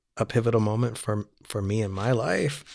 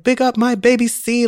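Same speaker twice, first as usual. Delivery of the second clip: Jamaican patois, pitched much higher; patois quote; 12 semitones higher, 5 dB louder than usual. Retold or imitated. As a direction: chirp it in a light Jamaican patois. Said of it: "she's typing in patois and she's like".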